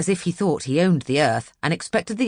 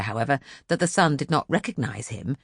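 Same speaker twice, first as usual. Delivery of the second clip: ordinary delivery, nothing marked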